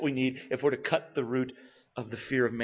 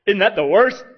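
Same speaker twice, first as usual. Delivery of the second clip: mock shocked question; 8 semitones higher, 11 dB louder than usual. Mock objection from listeners.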